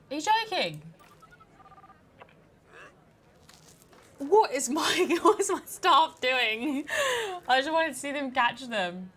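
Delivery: high, loud, incredulous outburst; loud, exasperated wail of protest; outraged disbelief. Real, not acted. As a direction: cry out at hopeless staff, half laughing. Staff fail to catch culprit.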